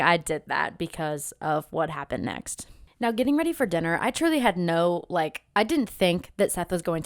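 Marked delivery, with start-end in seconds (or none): none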